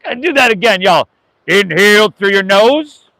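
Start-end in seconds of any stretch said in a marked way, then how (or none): 1.4-3.1 yoga teacher leading a breath; guided breathing. Acted. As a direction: guide the class through each breath.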